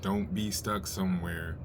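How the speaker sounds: normal talking voice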